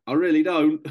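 loud, high-pitched retold protest; emphatic protest. Reluctant protest to checkpoint woman.

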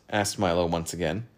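ordinary delivery

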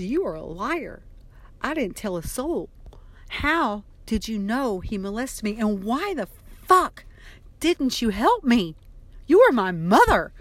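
building from tight accusation to a loud, slowed shout; rising fury until she's shouting at her mother; furious outburst. Retold or imitated. Daughter confronting mother over the abuse, building to a shout.

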